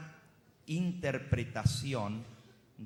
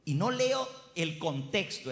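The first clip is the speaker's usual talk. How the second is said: ordinary delivery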